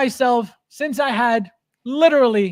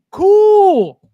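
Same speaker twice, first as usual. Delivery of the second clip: defiant shout; 11 semitones higher, 11 dB louder than usual. Real, not acted. Defiant streamer shouting at chat.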